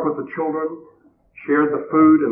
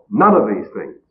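ordinary talk, nothing marked

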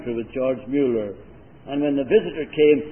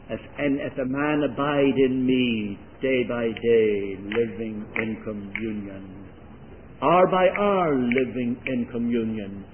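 slow, measured repetition; preacher building a slow litany; slow sermon cadence; 2 semitones lower, slower than usual. Acted. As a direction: lay each phrase down slowly.

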